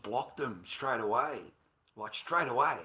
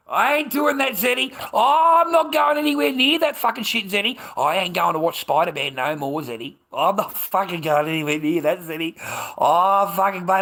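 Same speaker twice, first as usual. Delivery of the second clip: much higher, louder shouted refusal; shouting; 6 semitones higher, 13 dB louder than usual. Real, not acted. Telling Zed he refuses to moderate, shouting. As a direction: yell it out, flat refusal.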